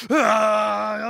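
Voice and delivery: short loud pained cry of disgust; a sharp yelp of annoyance; upset yelp. Retold or imitated. Crocodile yelping in disgust at Buggy's speech.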